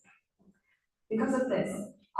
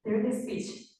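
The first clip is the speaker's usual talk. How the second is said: ordinary talk, nothing marked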